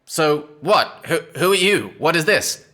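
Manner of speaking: a hint of a suspicious hag; old woman's voice. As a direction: crone questioning the strangers. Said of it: "she says".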